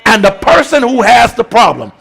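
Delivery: steady, emphatic sermon teaching; preaching. Acted. Pastor explaining intercession to the church, emphatic. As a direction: hammer home the picture of the gap.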